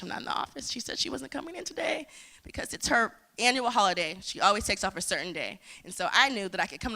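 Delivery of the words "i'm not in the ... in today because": hushed panic. Retold or imitated. Panicked reply to boss.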